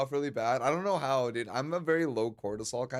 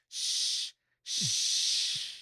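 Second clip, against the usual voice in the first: shushing; 6 semitones higher, much slower than usual. Acted. Hushing a taboo topic.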